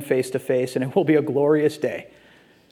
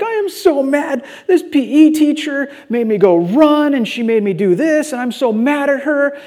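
imitated angry crying daughter; 9 semitones higher, 3 dB louder than usual. Sixth-grader complains to dad about her teacher, mock tearful anger. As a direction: play an upset preteen venting. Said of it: "she was just angry and mad".

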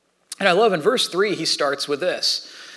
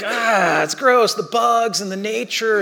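mock disgust; 5 semitones higher, 4 dB louder, slower than usual. Mocking disgust at camping.

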